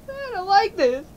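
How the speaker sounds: high-pitched worry, not yet shouted; uneasy protest. Acted. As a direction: protest anxiously, holding back the shout. Alarm building before the shout.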